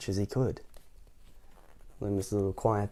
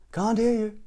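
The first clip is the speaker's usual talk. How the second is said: very high booming reply; 11 semitones higher than usual. Acted. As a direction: boom it at him. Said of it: "boomed the butcher".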